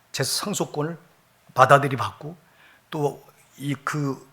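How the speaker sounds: normal talking voice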